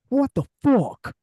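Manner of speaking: high, slow shocked outburst; wrestling promo. Retold or imitated. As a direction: blurt it out in disbelief. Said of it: "waiting for Rhea Ripley to go".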